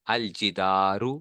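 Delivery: الجدارو said slowly as al-jidaaru, the l clear; الجدارو spoken slowly with a clear al; slow teaching model. Shown as a demonstration. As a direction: stretch out الجدارو, sounding the lam. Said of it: "لام کی آواز آ رہی ہے".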